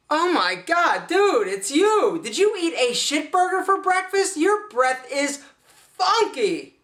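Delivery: high, slow, grossed-out voice; disgusted. Acted. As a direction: recoil from a stinky breath. Disgusted at viewer's breath.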